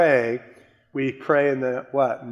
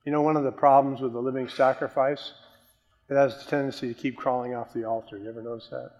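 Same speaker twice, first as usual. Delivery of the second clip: normal talking voice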